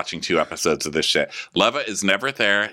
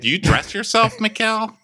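much higher teasing question; mock question. Podcaster teasing Mikkel about dressing, high mock question. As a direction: needle him in a singsong tease.

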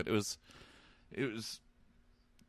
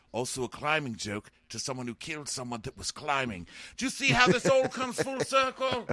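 high squeaky gloating voice; smug Tyrion impression; 9 semitones higher than usual. Tyrion gloating at Jaime about climbing. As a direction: crow it like a smug little man.

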